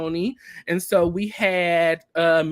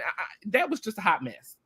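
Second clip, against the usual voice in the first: normal talking voice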